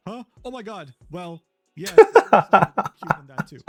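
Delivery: high, breathy-sounding flustered mock reply, building to a laugh; mock-flustered guy reading his own texts; acting flustered. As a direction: play him caught off guard and giggly. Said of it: "he would act flustered over and say".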